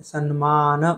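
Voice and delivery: सम्मान said as "sanmaana"; Telugu-style mispronunciation. Shown as a demonstration. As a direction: put an n before मान in सम्मान. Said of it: "सम्मान गलत है".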